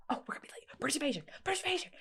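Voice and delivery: whispered, fast mock urgency; mock urgent scream. A daughter recalling her mom rushing to the show, whispered and fast. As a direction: hiss the band name in a hurry. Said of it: "She'd, like, scream".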